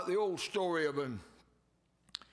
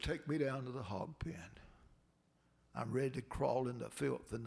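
weak whispered plea; 4 semitones lower, 4 dB quieter than usual. Retold or imitated. Dying master whispering to Mose to take him down. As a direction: whisper it from a sickbed.

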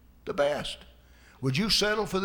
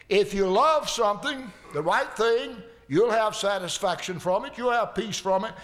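rising preaching fervour; 5 semitones higher than usual. Acted.

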